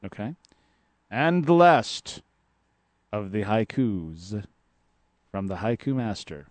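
slow, measured haiku reading; poem reading. Acted. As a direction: read each line like a poem. Reads the haikus aloud.

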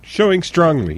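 high echoed mock British accent. Co-host echoes the UK phrase, high mock British accent.

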